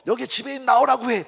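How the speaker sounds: high, indignant imitation of a customer; mock angry demand. Angry customer demanding action over spilled wine, acted indignation. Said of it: "막 화를 내더니".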